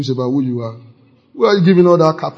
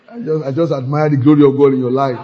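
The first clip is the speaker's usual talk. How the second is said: said through laughter; mock praise, laughing; 4 dB louder than usual. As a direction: chuckle through the flattery. Mock compliment while laughing.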